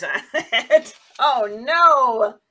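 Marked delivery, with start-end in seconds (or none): none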